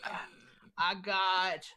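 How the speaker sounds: folksy old Southern lady with a hint of menace; old lady Southern accent. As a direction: play a sweet old Southern lady, lightly. Old lady Southern character intro.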